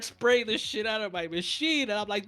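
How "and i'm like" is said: ordinary talk, nothing marked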